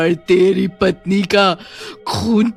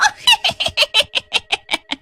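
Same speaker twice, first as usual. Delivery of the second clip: high-pitched witch cackle; evil witch cackle. Acted. Witch gloating after her kill, high evil cackle. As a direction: cackle in rapid high bursts.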